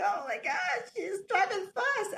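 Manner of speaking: high, half-laughing fright; laughing mock panic. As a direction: squeal it like a nervous passenger. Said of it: "I'm still petrified".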